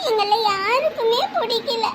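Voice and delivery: slow, tearful confession; crying. Acted. Child admitting to aunt why they cry, tearful. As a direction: drag it out through tears. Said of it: "யாராவது சும்மா அழுவாங்களா?".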